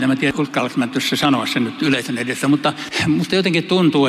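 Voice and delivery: ordinary talk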